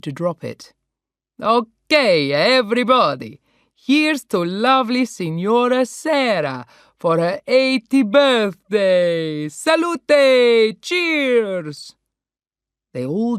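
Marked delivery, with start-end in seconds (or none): 1.4-11.9 put-on loud, festive Italian-accented cheer; Italian toast. Paolo toasting Sarah's eightieth, loud festive Italian accent. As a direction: raise the glass with gusto.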